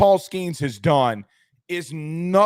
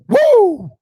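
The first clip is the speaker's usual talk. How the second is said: piercing high whoop, much louder; Ric Flair woo; 11 dB louder than usual. Acted. Stream host gives chat a Ric Flair woo, screaming high whoop. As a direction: let rip a wrestling-style woo. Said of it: "I want two claps and a Ric Flair".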